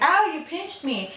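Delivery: high, drawn-out "ow" of protest; mock-hurt complaint, pitched well up; pained yelp. Housekeeper protesting to onlooker after a pinch, pained yelp.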